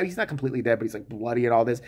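ordinary delivery

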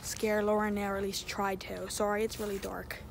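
hushed. Real, not acted. Sneaking up to prank friend.

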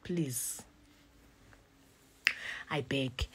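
soft pleading plea, almost whispered. Real, not acted. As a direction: beg quietly, drained. Pleading with viewers.

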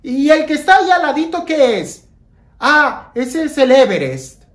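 mock surprised exchange. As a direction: ham up the silly dialogue. Playful mock dialogue about mountains.

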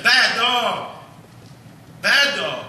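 scolding shout at the dog; bad dog tone. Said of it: "in that bad dog tone".

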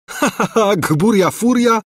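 high mocking laugh through the words; scornful laugh. A narrator's reading. Prince scoffing at Angelika, laughing.

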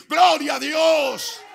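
high, loud, drawn-out shout of praise; overjoyed praise shout. Acted. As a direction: cry it out slowly, overflowing with joy. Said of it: "ponerse demasiado contento".

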